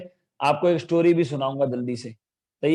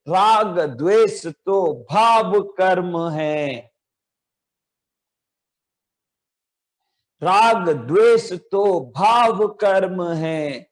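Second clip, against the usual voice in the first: slow, drawn-out chanted recitation of a poem line; slower than usual. Shown as a demonstration. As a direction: recite it slowly for children to echo. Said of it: "एक साथ बोलेंगे". (धीमा, खिंचा हुआ पाठ)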